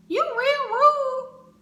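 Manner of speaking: drawn-out offended squeal; high slow indignant. Retold or imitated. To her date at the movies, a high, drawn-out offended squeal. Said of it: "I'm like".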